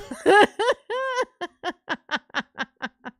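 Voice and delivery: loud high cackle; laugh. Real, not acted. Streamer laughing hard at her own punchline, loud cackle. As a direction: crack up loudly.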